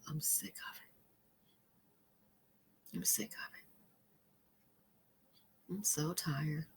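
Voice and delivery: weary whispered sigh. Real, not acted. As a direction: whisper it out of fatigue.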